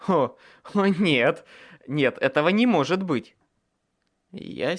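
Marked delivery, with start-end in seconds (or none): 0.1-3.3 в ужасе, не веря. A narrator's reading. Hero panics at early labour.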